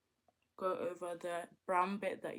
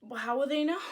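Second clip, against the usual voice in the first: teasing; 5 semitones higher, 9 dB louder, much faster than usual. Acted.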